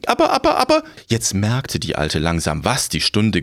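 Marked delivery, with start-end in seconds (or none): 0.1-0.8 high, flustered crone stammer; panicky old woman. Acted.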